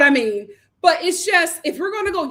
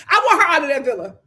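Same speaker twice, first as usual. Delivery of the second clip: ordinary delivery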